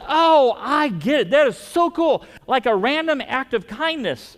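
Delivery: imitating the bank lady's thrilled realization; delighted bank lady. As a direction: gush like the thrilled teller. Bank lady's delighted reply, retold.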